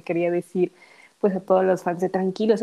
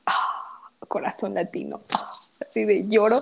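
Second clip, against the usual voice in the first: whispered and moved, almost tearful; a fan whispering through happy tears. Real, not acted. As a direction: breathe it out, touched. Moved by Argentina stage.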